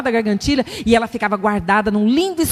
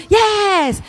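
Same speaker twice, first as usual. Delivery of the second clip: joyful yes; 8 semitones higher than usual. Acted. Joyful reaction to a promise.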